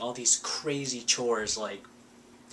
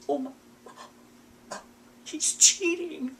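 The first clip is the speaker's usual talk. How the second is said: hammed-up high-pitched shock; mock gasp. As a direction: play the heartbroken girl, way up high. Mocking Selena's gasp at cheating.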